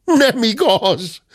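high, drawn-out denial; indignant outburst. A narrator's reading. Gentleman contradicting the cub, indignant outburst. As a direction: burst out slowly on a high pitch. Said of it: "tört ki a tekintetes úr".

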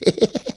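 a short high snicker. A narrator's reading. Hörpentő enjoying the prank, short snicker. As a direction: snicker to yourself.

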